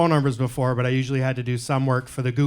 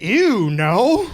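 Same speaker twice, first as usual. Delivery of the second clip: high, louder grossed-out dismissal; disgusted; 6 semitones higher, 5 dB louder than usual. Retold or imitated. Comedian answering the husband, high and grossed out. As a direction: recoil at the idea.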